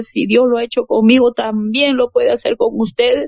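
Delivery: algo más aguda, predicando con convicción; predicación. Retold or imitated. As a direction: exhorta a la gente a creer. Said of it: "Yo le decía". (predicadora animando a la gente a tener fe)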